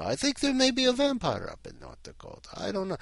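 high, fluttery voice; lightly hammed-up falsetto; mock worried. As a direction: raise it high in mock alarm. Joking aside about North Dakota vampires.

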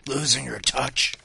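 lightly groaned undead taunt; zombie voice. A narrator's reading. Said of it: "groaned the zombie".

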